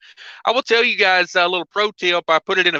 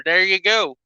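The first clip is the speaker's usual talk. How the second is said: ordinary talk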